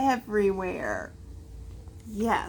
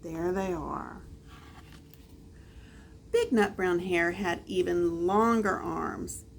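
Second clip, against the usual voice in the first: ordinary delivery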